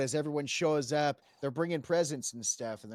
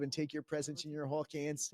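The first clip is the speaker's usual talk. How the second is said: normal talking voice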